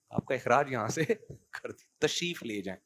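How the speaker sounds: the polite way, against the rude one; polite calm dismissal. Retold or imitated. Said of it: "ان کا style change نہیں ہوگا". (نرمی اور ادب سے رخصت کرنا)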